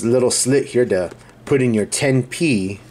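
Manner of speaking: ordinary delivery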